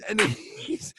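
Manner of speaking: ordinary delivery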